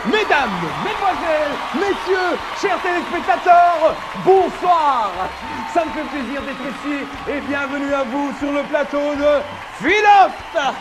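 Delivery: loud, slow, high game-show host; TV host announcement. Acted. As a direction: open the show like a TV presenter.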